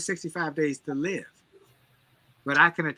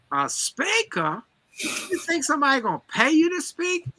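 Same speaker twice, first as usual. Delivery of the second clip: mocking disbelief, pitched up high and quick; doubting friend; 10 semitones higher than usual. Friend scoffing at the fired speaker's plan, high mocking disbelief. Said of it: "And he said".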